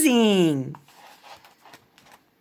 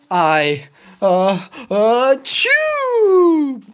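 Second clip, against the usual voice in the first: rising sneeze; 2 semitones higher, 4 dB louder, much slower than usual. Acted. Gerald sneezes while talking.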